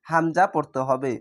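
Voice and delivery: ordinary delivery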